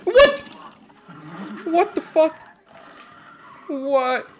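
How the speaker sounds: very high, loud yelps of disbelief; shocked squeal. Real, not acted. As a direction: shriek in stunned disbelief. Shocked by new boss move.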